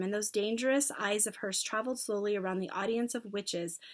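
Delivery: plain reading voice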